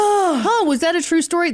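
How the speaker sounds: mock listener voice. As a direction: voice a wondering listener. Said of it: "If anyone is wondering".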